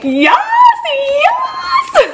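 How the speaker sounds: shouted, rising to a squeal; ecstatic cheer. Acted. Fan cheers as hero storms out, ecstatic shouting. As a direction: cheer them on at full volume.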